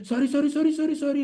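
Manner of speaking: rapid high pleading imitation; very humble. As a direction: apologise like a cowering soldier. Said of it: "become very humble and say".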